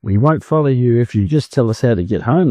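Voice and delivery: ordinary delivery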